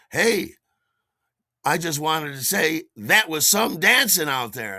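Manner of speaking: a star stopping to compliment; lightly voiced Treat Williams; impressed movie star. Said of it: "he says".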